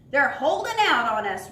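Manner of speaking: loud pirate roar; storybook pirate roaring. Acted. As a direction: roar it at the crew. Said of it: "roared the pirate captain".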